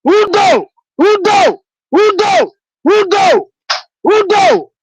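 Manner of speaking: high, ringing call across a crowd; hailing a leader from the crowd. Real, not acted. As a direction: call his name out high and long, again and again. Calling out to the leader.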